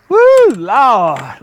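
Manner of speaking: loud, high whoop of real thrill; excited whoop. Real, not acted. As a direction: holler it out as the fish fights. Fighting a big fish.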